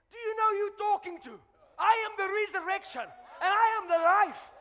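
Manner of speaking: loud, high preacher's shout as Jesus; thundering rebuke. Acted. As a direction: proclaim it with full authority. Preacher acts Jesus answering the sister.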